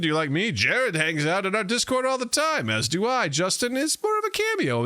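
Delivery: bright housekeeping read; podcast host read. Acted. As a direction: sell the show like an announcer.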